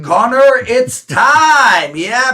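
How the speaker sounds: high, loud ringside announcer call; hammed-up high, loud announcer. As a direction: announce it like a big-time host. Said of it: "I always thought I'd be a great announcer".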